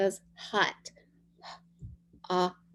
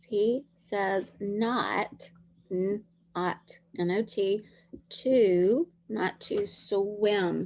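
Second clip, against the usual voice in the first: slow sounding out; much slower than usual. Shown as a demonstration. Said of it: "teacher sounding out words".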